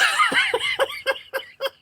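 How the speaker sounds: very high, wheezy cackle; real laugh. Real, not acted. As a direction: burst out laughing at the punchline. Laughs at the Santa Claus remark.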